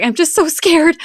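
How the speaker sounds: put-on high, whimpering little girl's voice; high, trembling child's fear; scared daughter. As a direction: voice a frightened child close to tears.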